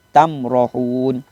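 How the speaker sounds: tamrohun slow, mim said clearly without nasal hum; slow recitation. Shown as a demonstration. Ustaz demonstrating izhar syafawi, slow recitation. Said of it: "jelas bunyi mim mati".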